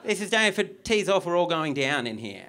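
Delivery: hammed-up fire hazard alarm; mock alarm. Mock alarm about theatre.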